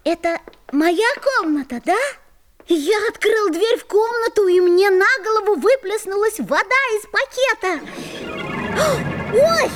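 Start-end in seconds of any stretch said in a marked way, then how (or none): 2.7-7.8 higher, excited account of a mishap; startled telling. Acted. Boy gets drenched by water. 9.3-9.7 high, loud yelp of shock; startled yelp. Acted. Water splashes on his head.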